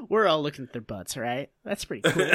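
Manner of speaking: low, fast folksy narrator voice. Retold or imitated. Narrator pausing the show to admire, low folksy voice. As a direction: drop low like a laid-back country narrator. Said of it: "[name] literally stops the show to say".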